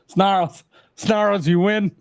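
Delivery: high, laughing through the words. Real, not acted. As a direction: crack up while you speak. Laughing reaction to dad joke.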